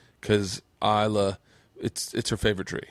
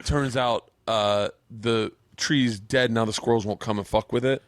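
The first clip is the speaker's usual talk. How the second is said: ordinary delivery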